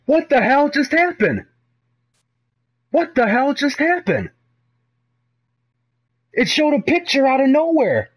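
freaking out in real fear, voice way up; terrified high yelps at a picture; panicked shrieking. Real, not acted. Jumpscare during horror game.

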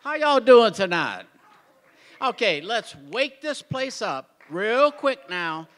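loud, high MC shout to the room; crowd hype call. Real, not acted. Emcee shouting to wake up the awards crowd.

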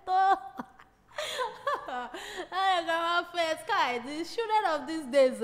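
laughing through the words. Real, not acted. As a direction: laugh while telling it.

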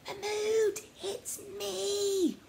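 put-on high, excited call of a boy; calling out to a startled friend; boy's high excited exclaim. A narrator's reading. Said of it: "Oliver exclaimed".

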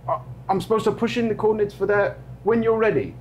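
put-on high, fumbling, halting kid voice; a flustered schoolboy searching for words; nervous kid voice. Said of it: "so he says".

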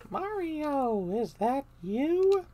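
high, slow sweet princess voice; Princess Peach voice. Acted. Player voices Peach greeting Mario, high and sweet. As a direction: raise it up high and gentle.

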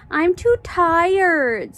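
high, loud drawn-out whine; sing-song childish complaint; whiny excuse. Acted. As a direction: whine it slowly, stretching the last word. Classmates join in the whining.

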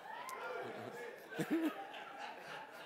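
laughter. Real, not acted. Audience laughter.

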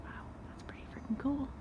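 whispering. Real, not acted. Awed, quietly watching something.

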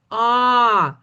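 А long and loud, falling at the end; sound held long. Shown as a demonstration. Teacher confirming the last sound, held long. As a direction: hold out a strong А. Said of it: "останній звук".

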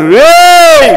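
loud, high sudden yell; angry shout. Shown as a demonstration. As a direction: shout it out in anger. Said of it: "పెద్ద ఎత్తున వచ్చేసి అరుస్తారు". (కోపంతో గట్టి అరుపు)

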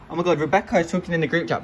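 ordinary talk

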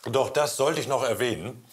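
normal talking voice

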